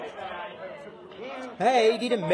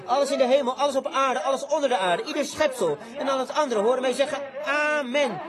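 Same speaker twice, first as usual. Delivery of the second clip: fervent preaching; 4 semitones higher than usual. Acted. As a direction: lift the verse up to the rafters. John's shout, preached at full force.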